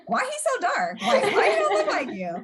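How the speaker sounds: high-pitched, laughing imitation of a baffled friend; mock incredulous question. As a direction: blurt it high, half laughing, in mock disbelief. Retelling friends' blunt questions about dad.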